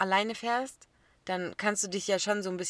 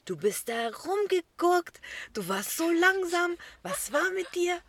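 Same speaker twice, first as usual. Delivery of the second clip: much higher, louder, slower mock father telling her off; imitating scolding dad; 10 semitones higher, 6 dB louder, slower than usual. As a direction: ham up the dad's complaint. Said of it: "Dann sagt er". (übertriebene Vaterstimme, höher und lauter schimpfend)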